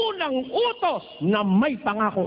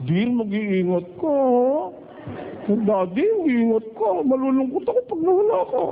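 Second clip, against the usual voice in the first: sweet wife. Retold or imitated. Sweet wife voiced tenderly.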